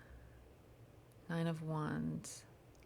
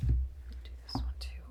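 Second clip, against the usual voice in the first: whispering; 4 dB louder than usual. Real, not acted. Whispered aside during chant.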